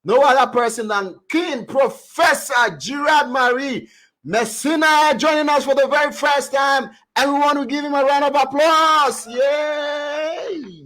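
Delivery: loud, high-pitched host introduction; excited announcer. Acted. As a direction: call it out to the crowd. Host calls out guest introduction.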